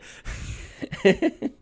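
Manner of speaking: high-pitched, wheezy burst of laughter; amused laugh. Real, not acted. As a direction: crack up at the absurdity. Laughing at absurd dubbed buildings.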